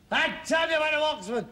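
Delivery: high, loud mock Scots; hammed-up Scottish exclamation; Scottish accent. Son urging father to pose as a Scot, mock Scottish accent. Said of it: "You can be Scottish".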